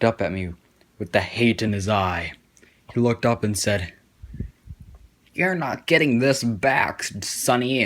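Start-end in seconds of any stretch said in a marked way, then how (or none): 5.3-7.8 a touch of a gloating teacher, higher; mildly mocking gotcha voice; grumpy teacher. Teacher snatching the hat.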